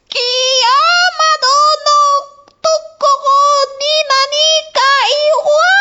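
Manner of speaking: high, slow, drawn-out terrified shriek; a long wailing cry in Japanese; sudden scream. Acted. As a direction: scream it in panic. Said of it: "elle hurla tout à coup".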